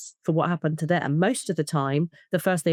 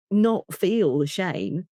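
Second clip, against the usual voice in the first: ordinary talk, nothing marked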